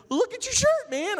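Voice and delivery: very high, loud and amused; amused pastor. Retold or imitated. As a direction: point it out through laughter. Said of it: "He's like".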